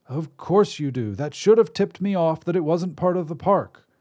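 put-on reedy old wizard. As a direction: apologize as a thin-voiced old wizard. Wizard voice held.

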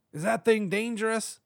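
high-pitched wary cop; high voice of an uneasy cop; cop character voice. A narrator's reading. Nervous cop asks about orb.

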